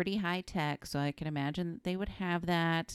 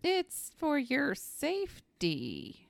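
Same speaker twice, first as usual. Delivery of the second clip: slow, high singsong reassurance; mock soothing nurse; 9 semitones higher, much slower than usual. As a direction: draw it out sweetly, pitched up. Joins in as the sinister nurse.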